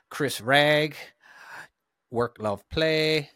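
drawn-out Texas-style roll call of names; exaggerated American accent. Shown as a demonstration. Host thanks patrons by name, exaggerated American accent. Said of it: "do your American accent".

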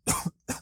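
a single dry cough; one quick unvoiced cough. Real, not acted. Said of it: "uma tosse aqui".

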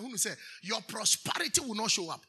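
ordinary talk

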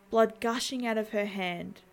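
ordinary delivery

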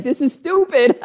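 high-pitched, about to crack up; amused disbelief tipping into laughter; laughing exclamation. Acted. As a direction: squeal it on the edge of laughter. Acting out a hysterical laugher.

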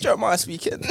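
high, loud phone-answering voice; customer service voice. Pretend. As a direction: answer the phone like a call centre. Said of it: "You should hear my work voice".

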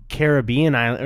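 "Caribbean" as kair-uh-BEE-un; third-syllable stress. Shown as a demonstration. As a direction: hit BEE in "Caribbean" before island. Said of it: "you don't say, like".

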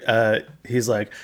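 normal talking voice

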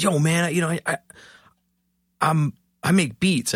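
a hint of the jittery stranger pitching himself; tweaky stranger. Stranger at the elevator, lightly voiced as a jittery tweaker. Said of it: "kinda tweaky".

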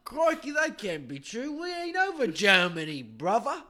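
high, loud mock Australian drifting British; hammed-up Australian accent that goes British; Australian slipping into British. Australian passenger disputes being over Germany, mock accent sliding to British. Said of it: "Oh, I keep going to British".

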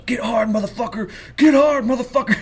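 high, loud motivational yell; David Goggins yelling. Retold or imitated. Runner yelling at his phone mid-run, hammed-up motivational shout. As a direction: imitate a runner screaming himself on. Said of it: "And he's just yelling".

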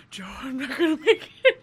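laughing through a fake wail; half-laughing groan of I'm not gonna make it; laughing mock despair. Laughing, she tells her friends she begged Joe at boarding. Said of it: "I'm like".

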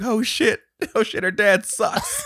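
high, loud dread breaking into laughter; mock alarm. Friend hears her dad is home, mock alarm. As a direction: wail it in hammed-up alarm.

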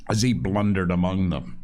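ordinary delivery

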